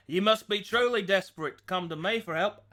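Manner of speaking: mock-grand line in an Australian accent. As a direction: play a self-important Australian demon hunter. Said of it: "Onimetsu are all Aussies".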